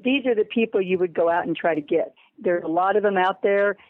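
usual speaking voice

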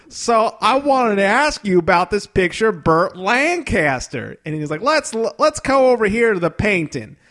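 high, slow, earnest host voice; Huell Hauser host. Retold or imitated. Host on the phone with owners, slow and high. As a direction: lead the way like a cheery TV guide. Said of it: "my really bad Huell Hauser impression".

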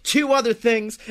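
ordinary talk

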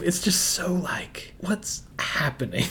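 hushed, baffled whisper. Real, not acted. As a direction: breathe it out as a puzzled aside. Mock bafflement at the weird scene.

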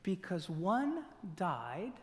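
slow, deliberate scripture reading, softened. Shown as a demonstration. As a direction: read slowly and softly, stretching each word. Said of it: "read it to you again slowly".

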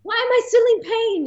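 high, loud squealing protest; mock outraged wail; indignant whine. Protesting more labour pain.

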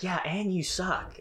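slow, high mocking jab; little sister taunting; high bratty jab. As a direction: drag out the insult like a bratty kid. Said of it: "Bella's like".

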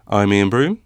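ordinary talk, nothing marked

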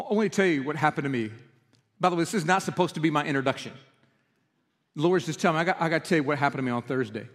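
ordinary talk, nothing marked

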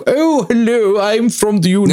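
high, mock-posh southern English; exaggerated posh British, pitched well up; posh southern British accent. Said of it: "posh down south kind of like".

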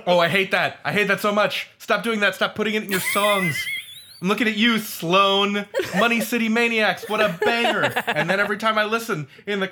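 hamming up annoyance for laughs; playful mock outrage. Real, not acted. Panelist teasing a guest about car noises, playful mock outrage.

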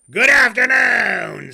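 slow, loud, furious shout. Acted. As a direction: roar the final goodbye. Final furious dismissal.

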